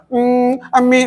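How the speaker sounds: high whiny call for mummy; mock whining child. Teacher imitating a child whining for its mother, high and whiny. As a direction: whimper for mum like a small child. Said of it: "बच्चे खातर".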